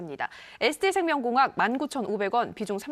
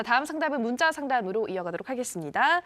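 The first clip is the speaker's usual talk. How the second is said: usual speaking voice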